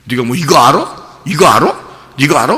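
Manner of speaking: rapid-fire repeated jabbing question; lightly acted scolding, pressing for an answer; mock challenge. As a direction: badger him with each repeat. God challenging Job, acted.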